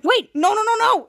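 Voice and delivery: high, loud protest; mock panic. Evil Henry as the lever gets pulled, mock panic. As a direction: rattle off the no's in acted alarm.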